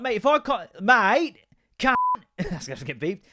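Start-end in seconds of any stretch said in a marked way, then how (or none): 0.0-2.0 high, loud voice of a protesting cat; indignant cat. Acted. Cat objecting to its owner's curfew, indignant voice. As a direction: protest loudly as a put-upon pet cat. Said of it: "a cat's like".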